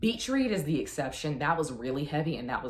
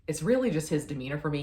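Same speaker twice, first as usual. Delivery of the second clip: ordinary talk, nothing marked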